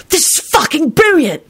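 high, delighted exclamation; happy enthusing. A narrator's reading. Sirius delighted amid the chaos, enthusing. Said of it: "he enthused happily".

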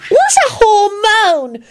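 put-on very high, slow child voice; drawn-out, high-pitched kid question; little boy. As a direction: ask like a baffled small child. Young Teddy asks loudly.

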